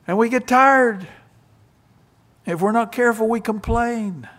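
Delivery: loud, high, driving preacher voice; preaching at full force. Acted. Preacher warning tired workers not to complain, preaching loudly. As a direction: build the sermon to a loud pitch.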